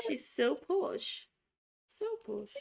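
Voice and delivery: high, drawn-out mock English accent; playful put-on posh English accent. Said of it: "English accents part two".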